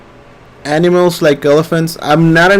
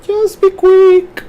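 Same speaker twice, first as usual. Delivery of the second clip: squeaky high voice; slower than usual. Acted. Urging viewer to hurry.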